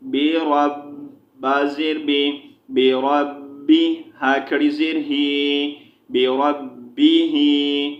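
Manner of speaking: measured chant, syllables held long; Quran spelling drill. Shown as a demonstration. Teacher spelling Quranic words for learners, chanted drill. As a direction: spell out each letter, then draw out the joined syllable.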